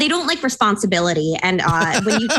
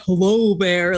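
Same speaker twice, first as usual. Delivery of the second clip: put-on big voice, lightly done; bear-scaring voice; slower than usual. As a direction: imitate yourself greeting a bear, goofily. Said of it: "you hear my voice. I sound like Minnie Mouse".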